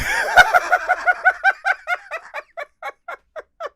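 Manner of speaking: loud, high-pitched cackle; high cackling laugh. Real, not acted. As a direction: burst out in a shrieking laugh. Big laugh after knee joke.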